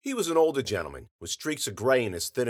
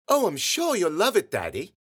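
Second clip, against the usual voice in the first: put-on high-pitched girl's squeal, settling on the last word; squealing little girl; 7 semitones higher than usual. A narrator's reading. Girl gushing to her Daddy about the find, squealing. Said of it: "one of the girls squealed".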